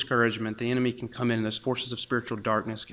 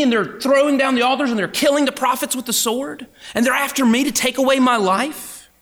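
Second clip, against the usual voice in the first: high, loud, rushed self-pitying complaint; whiny complaint; 11 semitones higher, 5 dB louder than usual. Retold or imitated. Elijah complaining to God, high and loud. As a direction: wail it out, building. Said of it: "And Elijah says".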